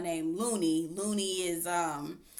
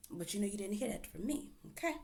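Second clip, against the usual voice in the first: much softer gossipy aside; hushed aside; 11 dB quieter, faster than usual. Real, not acted. Gossip disclaimer to viewers, hushed aside. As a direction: say it behind your hand. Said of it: "gossip aside".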